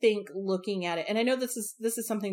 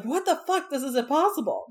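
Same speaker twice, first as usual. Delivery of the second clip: shocked disbelief; 7 semitones higher, 3 dB louder than usual. Retold or imitated. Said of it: "Not so much like".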